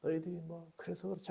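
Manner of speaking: hushed, understanding voice of Jesus; low-key, soft and forgiving; gentle, without shouting. Acted. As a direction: excuse them very softly, almost whispered. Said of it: "그들의 상황을 다 이해하시면서 외치지 않아요".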